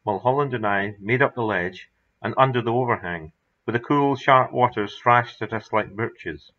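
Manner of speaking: ordinary delivery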